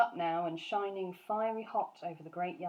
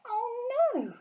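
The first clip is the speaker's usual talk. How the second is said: put-on high, louder girl's cry; cried out; 6 dB louder than usual. Sophie crying out at the giant, dismayed cry. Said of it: "cried Sophie".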